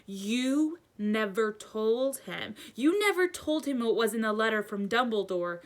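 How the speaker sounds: indignant tirade, rising in pitch; enraged. A narrator's reading. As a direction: accuse with mounting anger. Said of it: "his very syllable trembled with rage".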